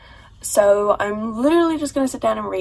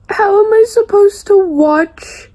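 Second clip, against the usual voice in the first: slow, high tearful plea; weeping through the question; crying; 7 semitones higher, 5 dB louder than usual. Real, not acted. Grieving a killed character.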